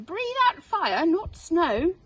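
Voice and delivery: teacher correcting the class; firm correction. Acted. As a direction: scold them firmly. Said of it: "said Madam Dragon".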